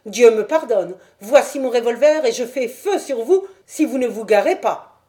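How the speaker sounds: hurler la menace, plus fort et plus haut; menace criée. Acted. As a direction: brandir le revolver de la voix. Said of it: "cria la voix". (vieillard armé qui hurle sa menace)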